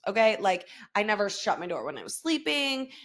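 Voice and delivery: ordinary talk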